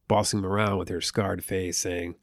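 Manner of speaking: ordinary delivery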